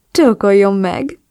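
slow, smiling order with a voice unlike the narrator; lightly voiced man, lazily demanding; grinning command. A narrator's reading. The man on the bed, grinning as he orders her. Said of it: "utasított vigyorogva".

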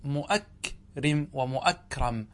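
مؤكرم slowly with the hamza sounded, first split as mu'ak, rim; slow, careful hamza in both مؤكرم; original forms with hamza. Shown as a demonstration. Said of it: "والأصل".

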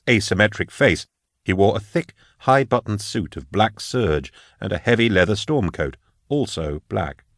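ordinary delivery

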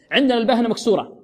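ordinary delivery

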